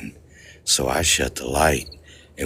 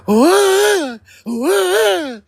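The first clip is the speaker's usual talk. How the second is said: loud, very high mock scream "wah"; two piercing high yelps imitating a terrified man; imitated gear-shifting scream; 7 dB louder than usual. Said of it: "his scream literally shifted gears in mid-scream".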